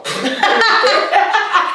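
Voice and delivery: shrieking high laugh; high cackle. Real, not acted. Laughing at friend.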